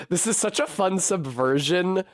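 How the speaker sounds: ordinary talk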